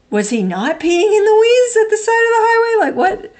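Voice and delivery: put-on shocked as the childhood story unravels; incredulous. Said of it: "I was like".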